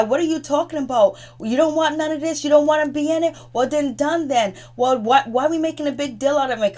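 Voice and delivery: hammed-up tearful shout at the group; retold yelling. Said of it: "Kristen, she's yelling".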